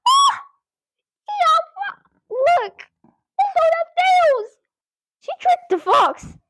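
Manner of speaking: thrilled discovery; delighted. Real, not acted. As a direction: squeal with delight at the trick. Reader delighted by trick.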